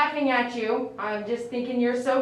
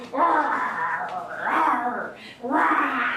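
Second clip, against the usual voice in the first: drawn-out pretend roar; playful mock growl; much slower than usual.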